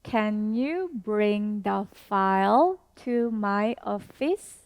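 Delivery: very slow, each word spaced out; slow teacher demo with a rising question end; slow model sentence. Shown as a demonstration. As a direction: model it slowly for learners to repeat. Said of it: "One more time".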